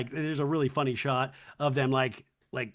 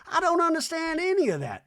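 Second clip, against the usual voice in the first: Reba voice; 14 semitones higher, 4 dB louder than usual. Retold or imitated. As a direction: drone it like a grand old dame. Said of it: "Reba in scene".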